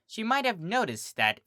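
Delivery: ordinary delivery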